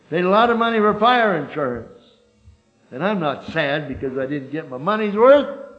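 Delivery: loud, high insurance sermon; full-force preaching. Acted. Preacher driving home insurance point, loud preaching. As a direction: press the point hard to the hall.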